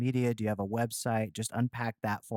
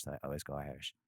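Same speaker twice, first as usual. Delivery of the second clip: normal talking voice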